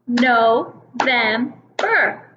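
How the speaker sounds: slow, syllable by syllable; clapping syllables. Shown as a demonstration. Teacher to kindergartners, clapping No-vem-ber out slowly. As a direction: clap out each beat of November. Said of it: "Let's clap and find out".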